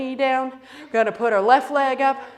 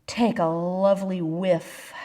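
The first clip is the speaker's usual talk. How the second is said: ordinary delivery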